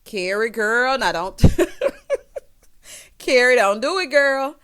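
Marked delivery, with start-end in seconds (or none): 1.4-2.4 loud high laugh. Real, not acted. Laughing at Carrie's shade.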